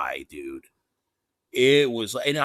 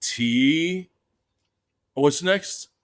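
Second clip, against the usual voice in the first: Sheik voice; 6 semitones higher, 3 dB louder, much slower than usual. Retold or imitated. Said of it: "he's like".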